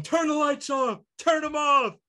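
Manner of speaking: high-pitched imitation of an angry yell; mock scream. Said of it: "he was screaming at the guy".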